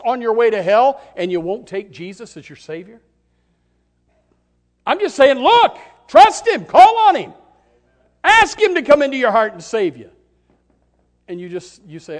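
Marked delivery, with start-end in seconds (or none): 4.8-10.1 high, loud, slowed pleading cry; preacher calling sinners to trust him; urgent loud plea. Acted. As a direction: beg them with all your voice. Pleading with the unsaved.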